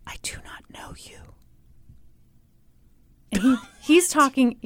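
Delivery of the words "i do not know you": imitating a scared whisper, barely audible; mock whisper. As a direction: breathe it out like a frightened witness. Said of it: "Here's how she did it".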